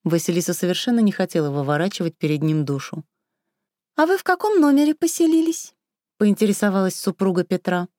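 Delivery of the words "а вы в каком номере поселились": squeaky wife voice. A narrator's reading. Raya asks, high squeaky voice.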